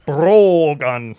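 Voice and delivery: lightly hammed Scottish brogue with a long rolled R. Shown as a demonstration. Author warns against overdoing a Welsh accent, mock Scottish brogue. Names the brogue while rolling it.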